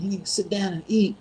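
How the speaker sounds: lightly voiced high grandma; scolding grandma. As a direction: imitate a grandma ordering a kid around. Grandma scolds teenage cousin.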